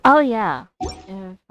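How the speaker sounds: ordinary talk, nothing marked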